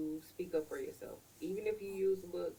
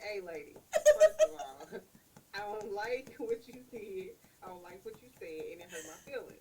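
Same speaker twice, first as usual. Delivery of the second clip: raised voice, laughing through it; half-laughing mock confrontation; confrontational; 6 semitones higher, 8 dB louder than usual. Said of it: "I was really ready to square up".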